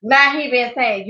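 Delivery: usual speaking voice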